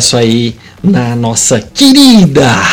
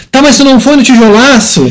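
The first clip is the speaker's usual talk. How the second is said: imitating a fan; 8 semitones higher than usual. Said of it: "o pessoal pergunta".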